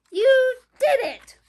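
high, very loud cheer; barking otter. A narrator's reading. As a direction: bark out praise like an excited otter. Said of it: "barked Otter".

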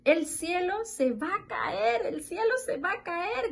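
high-pitched cry without real volume; storybook hens raising the alarm together; mock shouting hens. A narrator's reading. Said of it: "gritaron todas". (grito agudo de gallinas, sin gritar de verdad)

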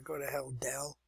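a fed-up aside, breathed not voiced; whispered curse. Real, not acted. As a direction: whisper it at the computer. Cursing the computer under breath.

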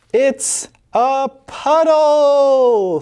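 high, very slow, drawn out puddle; little boy voice. Acted. Little boy showing mom the puddle, drawn out. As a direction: savor the word like a delighted kid.

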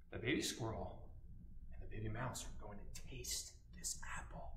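soft storytelling whisper. A narrator's reading. Teacher whispers the story to children. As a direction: whisper it like a secret.

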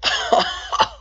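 shrieking laugh of disbelief; high giddy laugh. Real, not acted. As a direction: squeal of delighted laughter.

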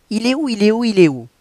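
high, loud panicked searching; frantic character voice. Acted. Reading the book aloud, a high, frantic heroine. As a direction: voice the heroine hunting for her lost tiara. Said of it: "on a affaire à quelqu'un qui bégaye".

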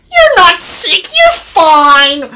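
high-pitched dismissive retort; dismissive engine. A narrator's reading. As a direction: brush off the complaint in a high voice. Engine scolds complaining truck.

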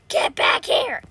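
loud growled chase call; rough shout. Pretend. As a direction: roar it after a fleeing foe. Chasing the rhino.

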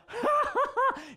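put-on high, giddy burst of child's laughter; imitated belly laugh. Dad imitating his little daughter, helpless belly laugh. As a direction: mimic a delirious belly laugh. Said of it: "a deep belly laugh".

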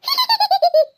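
high teasing cackle at her sibling; giggling in mockery; mocking high laugh. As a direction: burst out laughing at the joke. Sister teases about feeding her.

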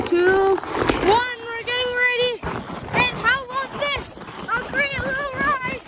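high, loud thrill mid-ride; sledding downhill. Real, not acted. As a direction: talk excitedly while sliding down. Sledding downhill, riding now.

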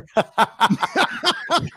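high squealing laugh; high burst of laughter. Real, not acted. Laughing at the bit.

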